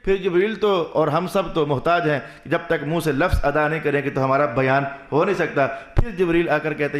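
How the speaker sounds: ordinary delivery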